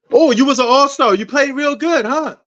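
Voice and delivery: high, mock-impressed lilt; mock admiration. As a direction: fake being impressed, pitch lifted high. Mimics fans questioning a ringless star.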